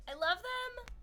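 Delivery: high gushing squeal over the boots; squealing delight. Real, not acted. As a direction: squeal it, thrilled.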